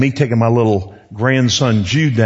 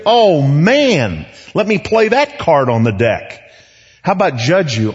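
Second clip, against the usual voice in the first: higher, rising with delight; excited preacher; 6 semitones higher than usual. Acted. Preacher voicing God eager to forgive, delighted and higher. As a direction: let joy climb in each answer.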